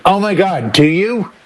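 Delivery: ordinary delivery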